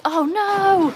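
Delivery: high-pitched, loud "oh no"; dismayed cry. Real, not acted. Sudden mishap during chat.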